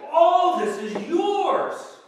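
very loud, slow and high, stretching all; loud slow cry. Acted. Father to his older son, a loud slow outcry. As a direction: cry it out, each word drawn long.

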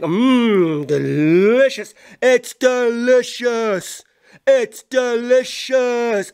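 very slow, drawn-out delicious; slow mock-gourmet drawl of delicious; savoring delicious. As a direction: stretch each word like it tastes amazing. Voicing someone tasting food.

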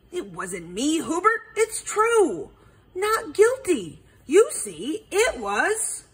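indignant denial, high and loud after the start; huffy protest. A narrator's reading. Beaver denying guilt to the detective, huffily. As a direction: protest it in a huff. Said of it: "Becky huffed".

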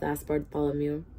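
ordinary delivery

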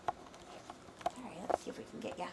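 whispering. Real, not acted. Whispering near puppy.

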